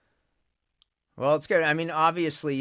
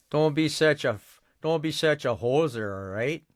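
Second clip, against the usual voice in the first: higher, exaggerated Canadian accent on hoser; hammed-up Canadian; 5 semitones higher than usual. Voice actor showing off a hammed-up Canadian accent after beers. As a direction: play the beery Canadian calling a buddy a hoser. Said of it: "start talking more Canadian".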